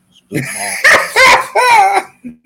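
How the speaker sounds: high, loud laughing yell; laughing shout. Real, not acted.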